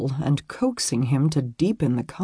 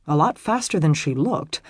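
plain reading voice

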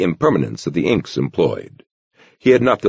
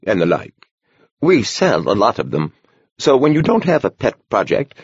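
lightly voiced old monk, higher; a gentle old monk speaking kindly; gentle old man voice; 7 semitones higher than usual. A narrator's reading. Horner explains perennials.

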